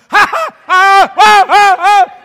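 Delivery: string of loud, high panicked yelps. Retold or imitated. Man holding a rat that revived, panicked yelping. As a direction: yelp over and over in mock terror.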